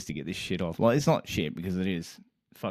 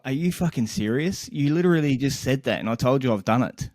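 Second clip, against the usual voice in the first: irritated; 5 semitones higher, 4 dB louder than usual. Retold or imitated. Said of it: "getting pissed off".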